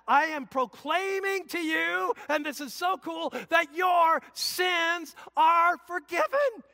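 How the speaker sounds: high, loud, slow proclamation; a ringing shout of good news; proclaiming shout. Real, not acted. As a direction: cry it out with joy. Pastor proclaiming forgiveness at peak.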